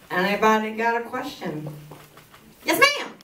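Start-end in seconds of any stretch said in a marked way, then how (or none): none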